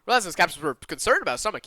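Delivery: plain reading voice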